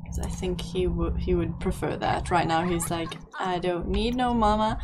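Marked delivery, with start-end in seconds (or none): 3.4-4.8 badly faked Southern accent. Toddler declaring independence from mama and papa, mock Southern accent. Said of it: "I don't know why he's sa-, southern, and I apologize. My, uh, my accents aren't that absolutely impressive".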